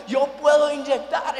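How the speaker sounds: frustrated insistence. Retold or imitated. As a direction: insist you can do it, annoyed. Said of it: "me pongo ansioso, me pongo enojado".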